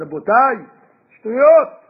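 loud, high public declaration; public proclamation. Retold or imitated. Egyptians declaring in public, loud and high. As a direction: shout it to the crowd.

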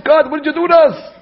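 high-pitched mock protest; aggrieved voice of a retold grumbler; acted complaint. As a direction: complain upward, as if wronged. Acted complaint of freed people.

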